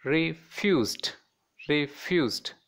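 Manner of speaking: "refused" split as re-fused; two separate syllables. Shown as a demonstration. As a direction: pause after "re" in "refused". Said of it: "two syllables".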